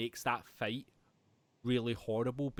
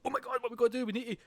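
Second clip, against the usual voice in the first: mock panic; 10 semitones higher, much faster than usual. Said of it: "I was like".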